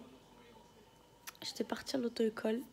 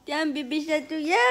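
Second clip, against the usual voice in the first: laughing while tickling; 6 semitones higher, 8 dB louder than usual. Real, not acted.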